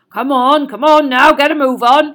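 loud hearty call across the lawn; booming giant calling the class over. A narrator's reading. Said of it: "he called".

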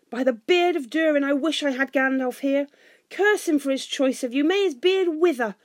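high, loud raging oaths; furious cursing. A narrator's reading. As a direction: cry out curses in a rage. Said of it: "he cried".

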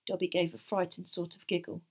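plain reading voice

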